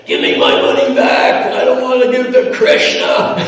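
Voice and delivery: high, loud retold angry demand. Man grabs the speaker and demands his quarter back, mock angry. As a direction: growl the demand, hammed up.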